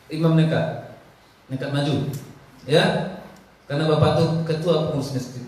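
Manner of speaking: usual speaking voice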